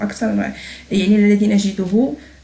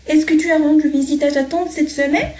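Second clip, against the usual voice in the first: high, rising question tune ending up on "semaine"; teacher demoing a rising question; question intonation; 6 semitones higher than usual. Shown as a demonstration. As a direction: lift "semaine" as a real question. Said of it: "غيرت نبرة صوتي".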